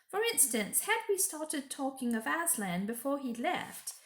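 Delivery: high, fussy motherly beaver wife; a busy animal mother scolding kindly; Mrs Beaver. Acted. Mrs Beaver warning the children, high and urgent.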